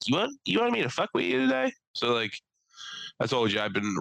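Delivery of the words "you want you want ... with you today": high mocking threat; truck voiced as taunting. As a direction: let the truck taunt him. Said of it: "it just started being like".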